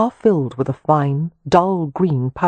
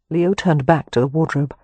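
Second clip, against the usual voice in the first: plain reading voice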